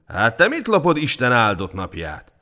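loud guard berating a peasant; overbearing, loud telling-off; gruff scolding. A narrator's reading. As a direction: scold him, building to a high, loud insult. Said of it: "mordult rá a hajdú".

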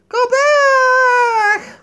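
high, loud wailing shout. Pretend. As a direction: wail it at the sky.

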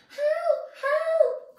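high panicked cry for help; high squeaky carrot. Acted. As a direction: squeal it like a tiny vegetable. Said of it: "cried Carrot".

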